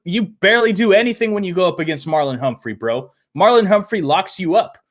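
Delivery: ordinary delivery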